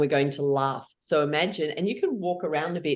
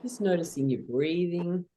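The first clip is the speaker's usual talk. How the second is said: guided meditation; 2 semitones lower than usual. Acted. Leader guides the group through a calm meditation. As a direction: guide them gently inward.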